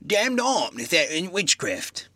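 slow, grave country blacksmith; rustic blacksmith. Acted. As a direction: speak it slowly like a village smith.

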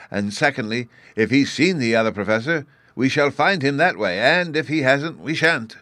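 a hint of a pompous lecturer; explanatory professor. A narrator's reading.

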